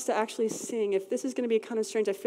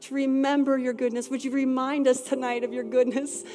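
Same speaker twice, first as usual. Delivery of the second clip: louder, higher pleading worship prayer; fervent prayer; 3 semitones higher, 3 dB louder than usual. Acted. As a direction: cry out to God with passion.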